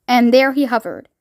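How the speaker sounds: ordinary delivery, nothing marked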